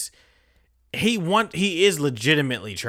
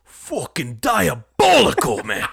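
put-on Butcher's British accent; 4 semitones higher, 6 dB louder, slower than usual. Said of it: "And in his accent".

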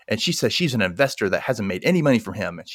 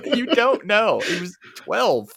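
higher, slowing on twelve, mock incredulous; pitched up, exasperated scoff; mock exasperation; 11 semitones higher, slower than usual. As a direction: drag it out in disbelief. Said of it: "I'm like".

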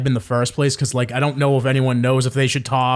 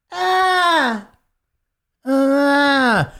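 put-on high wailing baby cry; imitated baby cry; 11 semitones higher than usual. Mimicking a baby crying behind them at the show, high wail. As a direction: wail like an infant in the back row. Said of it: "It's the baby crying in the back".

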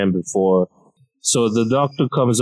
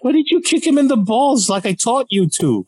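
much higher, incredulous scolding; exasperated dad; 12 semitones higher than usual. Retold or imitated. Dad scolding his injured son, high incredulous rant. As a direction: scold like a disappointed martial-arts dad. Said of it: "his first response was".

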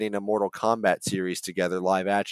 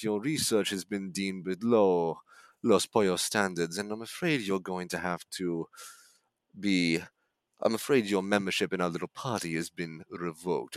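casually polite menace, a bit softer; breezy sociopath voice; 2 semitones lower, 6 dB quieter than usual. Retold or imitated. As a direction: fire someone like inviting them to a bonfire. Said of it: "the tone of a guy inviting you out to a bonfire".

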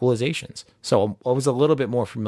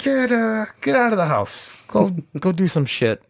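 Garlic Junior; 8 semitones higher than usual. Acted. Garlic Junior sends his boys out of the house, high mock command.

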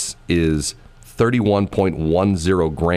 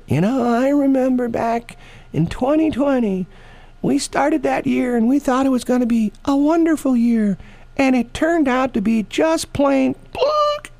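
much higher voiced old granny, slowing down at the end; imitating Shelley as a granny. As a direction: play Shelley as a grandmother telling her grandkids about twenty twenty. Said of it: "she'll be able to say".

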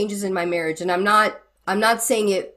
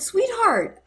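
lilting endearment pitched way higher; tender sweetheart; 12 semitones higher than usual. Retold or imitated. Counsellor to upset young wife, tender high sweetheart. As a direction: coax her gently with a high, sung endearment. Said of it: "And I said".

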